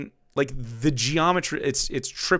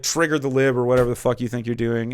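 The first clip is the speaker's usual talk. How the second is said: normal talking voice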